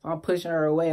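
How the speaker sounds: ordinary delivery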